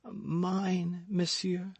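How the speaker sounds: high, soft, faint dying voice. A narrator's reading. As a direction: speak weakly as if dying. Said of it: "in a dying voice".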